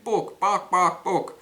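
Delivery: high, loud clucking book book; mock chicken clucking the word book. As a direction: squawk it like a chicken. Chicken to frog.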